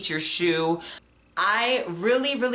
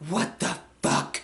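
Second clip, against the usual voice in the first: whispered disbelief; slower than usual. Retold or imitated. Disbelief in car.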